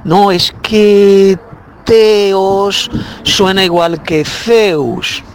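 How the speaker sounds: slow, drawn-out mock objection; mimicking an objector. Preacher imitating a Judaizer's objection, slow and drawn out. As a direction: stretch it out as the objector's complaint. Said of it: "cuando venga alguno de estos judaizantes diciéndonos".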